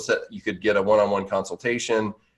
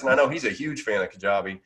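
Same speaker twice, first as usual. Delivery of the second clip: ordinary talk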